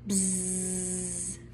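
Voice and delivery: quiet buzzing hum; soft bee buzz. Pretend. Teacher reading to class, soft buzzing bee. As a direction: buzz softly like a little bee. Bee sound in picture book.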